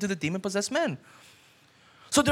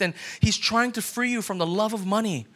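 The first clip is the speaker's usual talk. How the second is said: ordinary delivery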